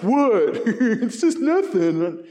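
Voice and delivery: high, loud, then slow laughing brush-off; mock innocent guy laughing off the mirror; dismissive laughing reply. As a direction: laugh it off like it's nothing. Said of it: "And he's like".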